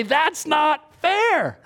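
whiny protest. Pretend. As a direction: drag out a sulky "that's not fair". Imagined inner grumbling.

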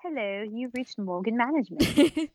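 a lightly put-on British accent on a phone greeting; fake British accent. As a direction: answer the phone like a mock posh British receptionist. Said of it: "a British accent or something".

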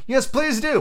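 high, eager plea; chorus of others. A narrator's reading. The others join Alice calling the mouse back, high plea. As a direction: chime in high-pitched. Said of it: "the others all joined in chorus".

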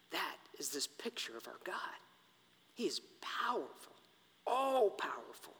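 soft awed whisper. Shown as a demonstration. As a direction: hush it like a secret. Sermon climax, whispered.

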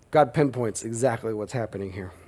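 usual speaking voice

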